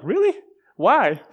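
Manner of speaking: taken aback and amused; surprised. Retold or imitated. Pastor reacting to stranger's blessing.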